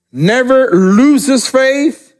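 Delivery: slow emphasis. Shown as a demonstration. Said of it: "Listen to that".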